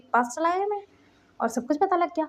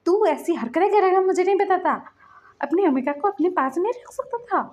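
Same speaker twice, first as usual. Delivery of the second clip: scolding uncle; 7 semitones higher than usual. Retold or imitated. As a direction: give him a stern telling-off.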